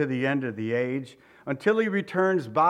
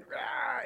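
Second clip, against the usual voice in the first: high-pitched mock newborn wail; imitated baby scream; 10 semitones higher, 7 dB quieter than usual. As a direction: mimic a screaming infant, not at full volume. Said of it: "she's screaming and yelling".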